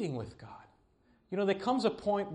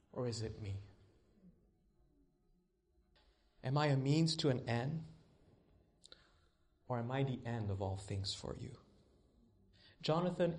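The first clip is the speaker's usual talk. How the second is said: God's voice, quiet; 5 semitones lower, 4 dB quieter than usual. Retold or imitated. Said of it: "this is what I heard God say".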